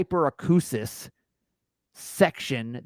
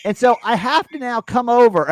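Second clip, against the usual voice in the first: high, loud explaining through laughter; over-enunciate loudly to a deaf passenger; mock flight attendant still yelling; 9 semitones higher, 7 dB louder than usual. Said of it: "they're still talking like".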